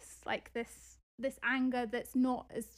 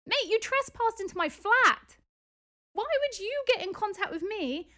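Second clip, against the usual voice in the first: indignant outburst; 6 semitones higher than usual. Real, not acted. Furious about trespass into flat.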